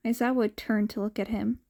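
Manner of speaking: ordinary delivery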